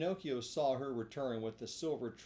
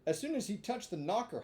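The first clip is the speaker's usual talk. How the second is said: plain reading voice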